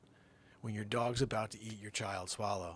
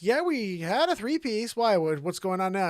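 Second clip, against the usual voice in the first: higher, louder wife voice; 11 semitones higher, 11 dB louder than usual. Retold or imitated. Wife confirming the couch, higher voice. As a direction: answer bright then suspicious.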